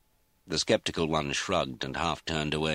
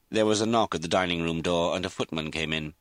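ordinary delivery

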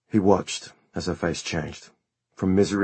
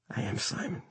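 unvoiced hushed plea; whispered; 13 semitones higher, 10 dB quieter than usual. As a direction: breathe it to her. Son answers frightened mother.

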